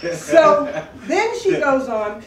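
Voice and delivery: laughing through the words, high and loud. Real, not acted. Recalling the psychic's prediction, laughing high and loud. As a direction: giggle out the line.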